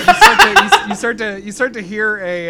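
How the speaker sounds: loud, high, laughing through the words. Real, not acted. Game master resumes the scene over table laughter, laughing. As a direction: try to narrate through laughter.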